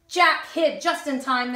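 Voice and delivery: plain reading voice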